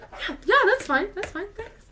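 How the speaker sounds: flustered agreement. Retold or imitated. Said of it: "I was just kinda like".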